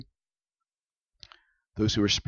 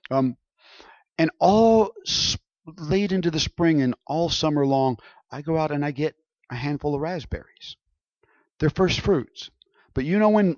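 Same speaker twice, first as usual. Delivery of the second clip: ordinary delivery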